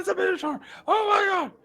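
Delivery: high-pitched, loud panicked shrieking; horrified shriek. Acted. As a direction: shriek in hammed-up terror. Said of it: "shrieks in horror".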